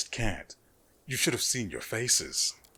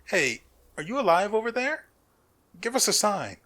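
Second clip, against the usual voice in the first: loud, high call across a distance; 10 semitones higher, 7 dB louder than usual. Acted. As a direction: shout over to them. Calling out to distant figure.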